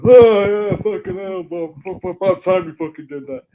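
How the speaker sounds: crowing with mock delight, voice shooting up; gleeful outburst. Mate gloating at the bullied porter, high and loud. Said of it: "The mate's gone".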